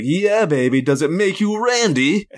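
high, campy British swinger voice; Austin Powers impression. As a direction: purr it like a groovy spy.

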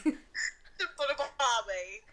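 slow, drawn-out mock Aussie; put-on Australian accent. Shown as a demonstration. Friend echoes the steakhouse order, put-on Australian accent. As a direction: stretch it out in a fake Australian accent. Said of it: "do an Aussie accent".

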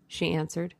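usual speaking voice

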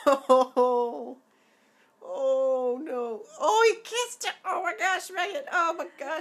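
high, loud gushing squeals; swooning squeal. Real, not acted. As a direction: gush like your heart is melting. Swooning over an on-screen kiss.

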